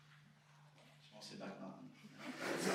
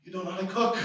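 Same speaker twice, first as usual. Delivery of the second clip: higher, louder imitation of mom; mother's blunt verdict; 7 semitones higher, 10 dB louder than usual. Comic quotes mom on why women reject him, loud mock scolding.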